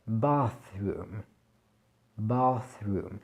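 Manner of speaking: Bathroom with tongue between teeth for th; Bathroom, unvoiced th; bathroom, th langue entre les dents; English th. Shown as a demonstration. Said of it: "c'est le son th".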